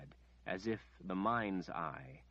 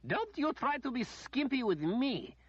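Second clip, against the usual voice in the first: high, loud screeching demand; the lady screeching not to skimp her dose; 4 dB louder than usual. A narrator's reading. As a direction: screech at the healer. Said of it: "Lady Ordith screeched".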